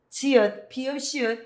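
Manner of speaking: ordinary talk, nothing marked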